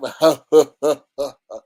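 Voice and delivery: loud mock guilty chuckle; guilty laugh. Imitating the streamer's guilty laugh. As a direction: laugh like you're dodging the question. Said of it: "That's the guilty laugh".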